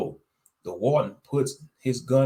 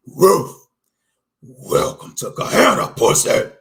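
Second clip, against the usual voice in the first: high, loud taunting bark; taunting snarl; 7 semitones higher, 10 dB louder than usual. Acted. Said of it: "Hilly taunted me".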